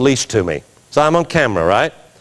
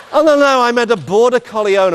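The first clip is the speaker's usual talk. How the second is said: high mock protest; 9 semitones higher than usual. Acted reply in judge routine.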